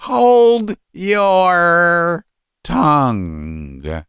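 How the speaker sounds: high, hugely drawn-out roar; father bellowing his son into silence; very loud shout. A narrator's reading. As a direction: stretch each word into a furious yell. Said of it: "so loudly that the spectacles fell".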